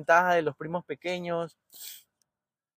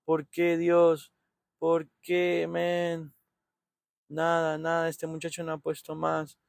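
usual speaking voice

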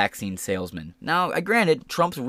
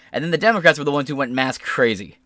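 ordinary talk

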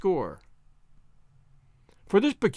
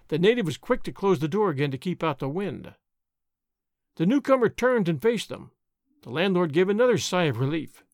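ordinary delivery

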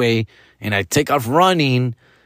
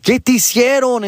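higher, alarmed mom voice; imitating a worried Mexican mom; 11 semitones higher than usual. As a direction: fire off questions like a frantic mother. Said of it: "my mom's like".

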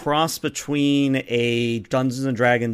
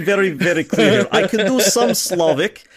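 put-on Slavic accent, higher and louder; 7 semitones higher, 5 dB louder than usual. Shown as a demonstration. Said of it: "I'm trying to mix Connery with Slavic".

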